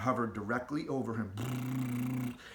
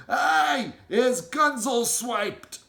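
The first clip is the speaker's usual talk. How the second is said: slow, much higher, loud shout; shouting giant; 10 semitones higher, 8 dB louder, much slower than usual. Acted. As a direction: shout it in a rage. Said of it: "shouted the meat dripper".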